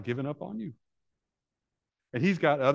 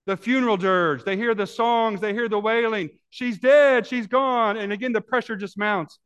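high, loud, urgent preaching; preaching at full force; 10 semitones higher, 7 dB louder than usual. Acted. Preacher describing the crowd approaching, full-force preaching. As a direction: drive the tension home from the pulpit.

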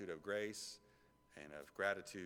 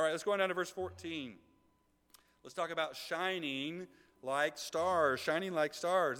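raised, emphatic sermon voice; preaching; 6 semitones higher, 6 dB louder than usual. Acted. Pastor urging the church to shine, raised preaching. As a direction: lift the congregation on stars.

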